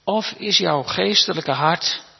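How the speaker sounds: ordinary talk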